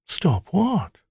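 high, coaxing temptress voice; seductive maiden. Acted. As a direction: purr it like a temptress.

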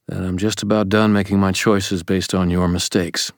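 ordinary delivery, nothing marked

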